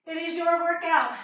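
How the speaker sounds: ordinary talk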